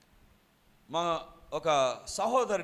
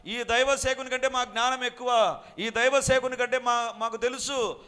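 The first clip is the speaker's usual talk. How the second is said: arrogant boast; 8 semitones higher, 8 dB louder than usual. Retold or imitated. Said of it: "అనుకొని విర్రవిగారు".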